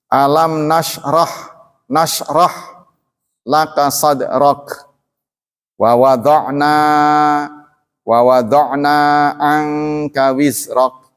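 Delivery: Quranic recitation, slow and measured. Shown as a demonstration. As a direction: recite slowly, timing each sukun. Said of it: "latihan untuk mengukur". (tilawah perlahan)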